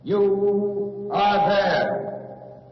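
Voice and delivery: ordinary delivery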